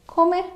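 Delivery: "come" pitched high as a short question; questioning intonation. Shown as a demonstration. Teacher shows the shortened form to learners, "come" as a high question. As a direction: toss out "come" as a high query. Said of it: "using the correct intonation".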